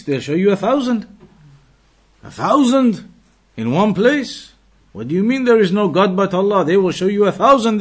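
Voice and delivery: normal talking voice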